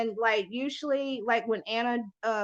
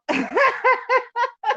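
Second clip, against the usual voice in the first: high, loud cackle; real laugh; 13 semitones higher, 8 dB louder than usual. Real, not acted. Host laughing at her own Fireball joke, loud laugh. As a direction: burst out laughing.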